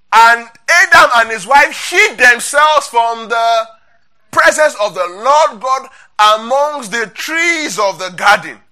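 loud, slow proclamation of scripture; scripture reading. Acted. Preacher reads Genesis aloud to the congregation, loud scripture reading.

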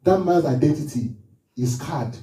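ordinary talk

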